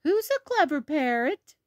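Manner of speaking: high, cooing voice to a pet bird; high-pitched praise for a pet; Granny Pig. Acted. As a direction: coax the parrot to copy you. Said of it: "Granny Pig says".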